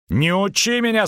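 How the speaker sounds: high-pitched, irate snap; angry bark. A narrator's reading. Red-faced merchant cuts off his double's lecture, barking. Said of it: "рявкнул Дайнти".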